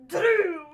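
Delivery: "Drew" loud with an attempted rolled R. Shown as a demonstration. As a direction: try rolling the R in "Drew" loudly. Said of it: "a lot of rolling the R's".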